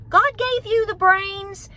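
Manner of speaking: normal talking voice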